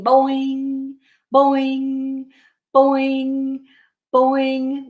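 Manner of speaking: springy monotone boing with ringing end; trampoline boings. Acted. Bear jumps on the trampoline, springy boings. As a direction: bounce each word and hold the hum.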